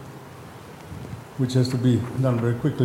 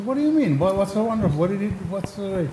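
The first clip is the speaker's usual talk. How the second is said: incredulous scoff; 7 semitones higher, faster than usual. Retold or imitated. One disciple mocking the other's rabbi story, high incredulous scoff. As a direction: voice jumps high in mock disbelief.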